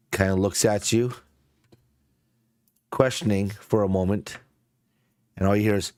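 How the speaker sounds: ordinary delivery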